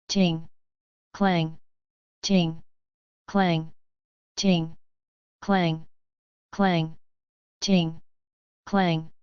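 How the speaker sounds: low, even falling clangs in a steady beat; rhythmic clang and ting. Acted. Narrator beating out the flurry of sword blows as low clangs.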